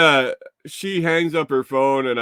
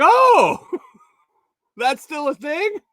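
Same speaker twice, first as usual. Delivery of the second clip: very high startled oh, then puzzled; shocked old lady; 7 dB louder, slower than usual. Retold or imitated. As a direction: play her truly baffled. Old lady learns of holiday.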